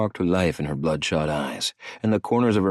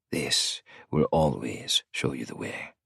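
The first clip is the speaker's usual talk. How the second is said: tender; 5 semitones lower, 5 dB quieter, slower than usual. A narrator's reading. Tender hand on her stomach.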